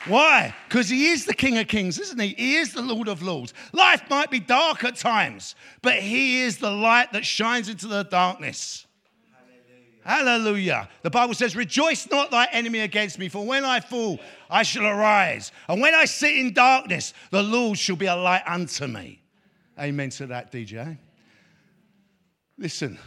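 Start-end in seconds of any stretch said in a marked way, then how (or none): 0.1-8.8 raised, higher preacher's proclamation; rousing sermon delivery, pitched up and punched out; full-force preaching. Acted. Preaches to the congregation. 10.0-19.2 raised, higher preacher's proclamation; rousing sermon delivery, pitched up and punched out; full-force preaching. Acted. Proclaims scripture to the congregation.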